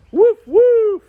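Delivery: high, loud barking woof; dog voice. Pretend. Dog character barking at the weather viewers. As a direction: bark like a playful puppy.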